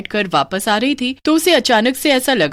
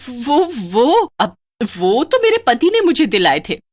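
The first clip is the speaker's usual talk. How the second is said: flustered; 5 semitones higher than usual. Acted. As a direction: fumble the excuse. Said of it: "Manisha घबरा जाती है". (घबराई हुई बहाना)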